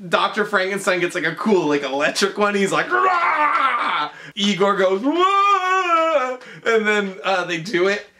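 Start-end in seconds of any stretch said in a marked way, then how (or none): 2.9-4.1 high-pitched imitated "rah" cry; mock roar. 5.0-6.4 high-pitched imitated "wah"; mock wail. Imitating Igor's cry.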